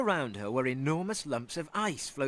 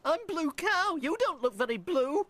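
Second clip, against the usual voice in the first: high, fast, wondering cow; a dreamy blue cow wondering aloud; 14 semitones higher than usual. Acted.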